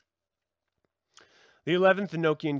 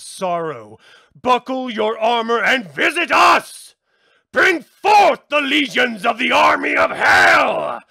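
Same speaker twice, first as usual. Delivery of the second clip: higher and louder, shouting a summons to hell's legions; ritual invocation; 5 semitones higher, 14 dB louder than usual. Acted. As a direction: summon the army with full force. Twelfth key shouted.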